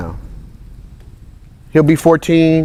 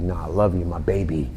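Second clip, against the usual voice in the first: tender mushy dad; 8 semitones lower, 9 dB quieter than usual. Retold or imitated. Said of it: "And I'll be like".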